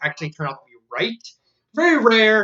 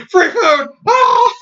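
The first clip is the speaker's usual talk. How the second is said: high, loud cry rising into a shriek; excited yell; 13 semitones higher, 8 dB louder, much slower than usual. Retold or imitated. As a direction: scream it like the best news ever. Retold character yelling excitedly.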